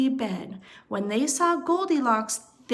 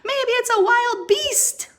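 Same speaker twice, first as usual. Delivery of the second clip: high, loud squeal; much higher and louder, squeaky and alarmed; squealing little bear; 13 semitones higher, 7 dB louder than usual. Acted. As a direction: squeal it like a scared little cub. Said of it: "Little Wee Bear squealed".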